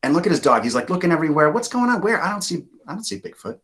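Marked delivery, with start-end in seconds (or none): 1.5-3.6 confused dog. Acted.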